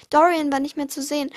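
ordinary delivery, nothing marked

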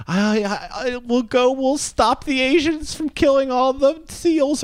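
high, slow naive do-gooder voice; mock-earnest save-the-world voice; mocking the earnest activist. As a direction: parody a starry-eyed activist. Mocking the documentary guy.

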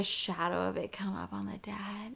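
hushed whisper for the creepy bit; spooky whisper. Retold or imitated. Friend retelling a horror movie, in a spooky whisper. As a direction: whisper it like a ghost story.

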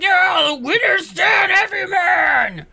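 high, drawn-out hollered cheer; hammed-up shout for the winner; acted victory cheer. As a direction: holler it slowly over the arena. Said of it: "they're like".

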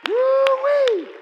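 high, loud woo-wee; a preacher's joyful holler; excited whoop. Real, not acted. Preacher's exclamation.

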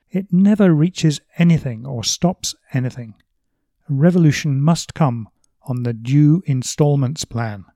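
emphatic reading of a novel's dialogue; animated, declaimed book reading; literary reading. Acted. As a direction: read the quotation out with loud, punched key words. Reads Bloom's quotation aloud.

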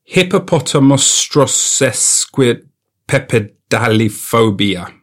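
slow, syllable by syllable; sounding it out. Real, not acted. First try at pronouncing a long word, sounding it out. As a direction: feel carefully through each chunk of "Hippopotamostrosesquipepedaliphobia". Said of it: "I'm gonna try and pronounce blank".

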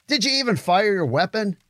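high-pitched taunting question; needling challenge. Acted. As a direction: needle him for hanging back. Soldier taunting lone comrade.

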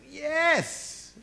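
high, bright burst of joy; excited yes. Retold or imitated. Paul receiving the gift, high excited cheer. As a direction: cheer like you just won. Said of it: "He gets excited".

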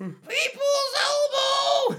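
high, slow shouted wrestling call. Pretend. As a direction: scream the move name. Shouting wrestling move name.

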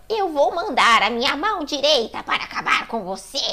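hammed-up villain threat; mock menacing proclamation; Salazar. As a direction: declaim it like a game villain. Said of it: "o Salazar fala".

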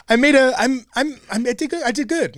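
high girly mimic of her; squeaky imitation of the dancer; mimicking the dancer.

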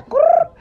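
high, loud mock growl; a sassy little growl; playful growl. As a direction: growl like a teasing cat. Growl at Jonas joke.

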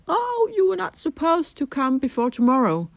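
high, startled blurt from the mentor; surprised blurt. Retold or imitated. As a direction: blurt it out, caught off guard. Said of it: "he just blurted out".

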